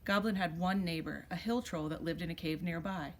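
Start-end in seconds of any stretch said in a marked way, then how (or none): none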